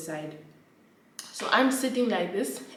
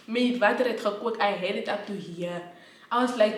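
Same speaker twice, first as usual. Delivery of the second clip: usual speaking voice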